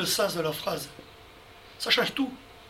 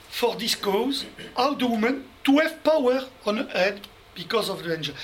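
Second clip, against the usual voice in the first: French-accented English. Shown as a demonstration. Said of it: "vous allez excuser mon accent".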